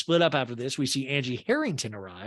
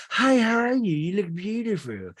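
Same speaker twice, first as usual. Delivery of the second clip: loud lilting hello that drops away; Meredith; 6 semitones higher, 4 dB louder than usual. Retold or imitated. Meredith greets Dana with a hug, imitated high gushing. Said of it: "And Meredith's like".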